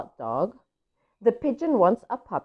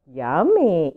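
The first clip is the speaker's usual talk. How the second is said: ordinary delivery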